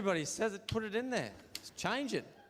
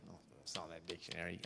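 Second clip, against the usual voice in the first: mocking echo; 8 semitones lower, 11 dB quieter, much faster than usual. Mocking girlfriend's complaint.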